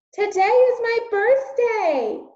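high, loud, slow call across the distance. Acted. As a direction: shout it after the passing royals. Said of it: "Meg called out".

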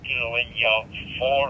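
usual speaking voice

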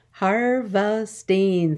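"Harvesting" stretched slowly into har-ve-sting; slow, drawn-out beats on "Harvesting"; syllable by syllable. Shown as a demonstration. Teacher confirming three syllables, "Harvesting" said slowly in parts. Syllable lesson, word broken up.

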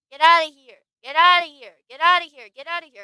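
loud shouted dismissal, over and over; repeated yelling. Acted. Shooing someone away with loud repeated shouts.

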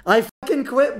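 high, loud frustrated outburst; rage. Real, not acted. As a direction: blow up like you're about to quit. Raging after losing fight.

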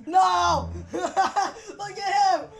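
slow, laughing out the words. Real, not acted. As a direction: crack up while pointing him out. Laughing at friend eating spicy noodles.

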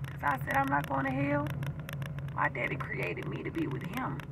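near-whispered intimate testimony; hushed testimony. Real, not acted. Testifying softly to viewers about God's love, hushed. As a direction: confide it in a hush.

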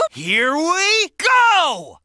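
hyped. Acted. Launching into action.

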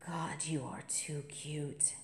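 soft, slow whisper; hushed, fond whisper. Acted. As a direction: breathe it out as a private aside. Aside about the freshman's kiss.

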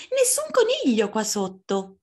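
high-pitched little fox voice. Acted. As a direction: call it high like a tiny fox.

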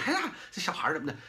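high, fast, fond scolding that brushes it off; indulgent grandparent. Retold or imitated. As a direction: coax and laugh it away like a doting grandma. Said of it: "有的人说还乐呢".

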